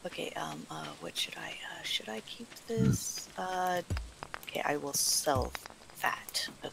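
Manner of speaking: very quiet, half-whispered murmur; muttering to self. Real, not acted. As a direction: think aloud under your breath. Muttering to self over game choices.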